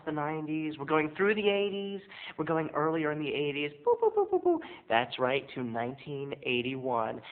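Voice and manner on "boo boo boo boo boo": high sung boo boo effect; high-pitched rewind noise; time travel whoosh. Pretend. Comic rewinding back in time, high sung boos.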